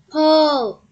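loud, high, held-out call; called command. A narrator's reading. Farmer rallies everyone to tug, calling. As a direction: call the heave on three.

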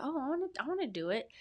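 high, quick eager burst; retold excitement. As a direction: recall the sudden spark of wanting in. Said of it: "I got excited again".

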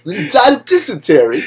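lightly mocking movie voice; mock Walken. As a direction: toss it off like a silly impression. Mimicking Walken monologue, higher voice.

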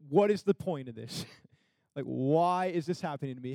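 ordinary talk, nothing marked

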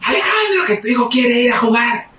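high mock holler for the husband; shrieky tattling mother voice, imitated; complaining mother. Mother calls father about son going out, high imitated holler.

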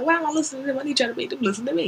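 half-joking plea to himself; high, fast inner plea. Retold or imitated. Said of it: "I'm like".

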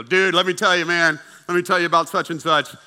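loud, pushy gossiping coworker; acted excited office busybody; eager gossip. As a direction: burst in bursting to share dirt.

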